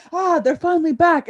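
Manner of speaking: imitating relieved listeners. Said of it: "everyone's listening in going".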